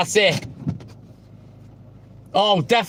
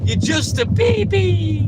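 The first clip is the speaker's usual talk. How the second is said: drawn-out high mocking baby voice; mock baby talk; 8 semitones higher, slower than usual. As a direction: taunt him like a toddler. Teasing Aziz in baby talk.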